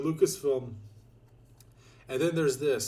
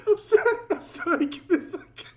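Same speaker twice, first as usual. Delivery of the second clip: high-pitched apology choked with laughter; breathless giggling plea for a moment; laughing apology; 5 dB louder than usual. Real, not acted. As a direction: squeeze out the sorry through helpless laughter. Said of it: "I shouldn't be laughing".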